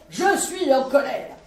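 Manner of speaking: colère appuyée, lente et forte; martèle sa colère, lentement. Acted. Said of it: "t'as l'air en colère". (soldat fâché répondant au vieux, avec une colère lente et forte)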